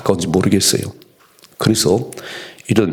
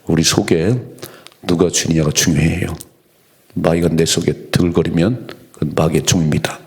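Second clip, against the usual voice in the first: usual speaking voice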